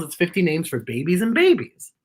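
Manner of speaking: usual speaking voice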